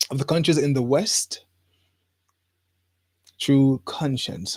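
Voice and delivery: ordinary talk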